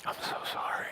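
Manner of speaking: near-whispered, halting regret; very soft, acted grief; sorrowful apology. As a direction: barely get the apology out. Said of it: "he can't even make eye contact".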